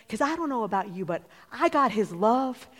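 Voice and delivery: climbs from firm to a full shout; building preacher shout. Real, not acted.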